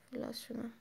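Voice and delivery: hushed, voice held down; quiet. Real, not acted. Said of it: "fazla ses yapmayacağım. Evde uyuyanlar var".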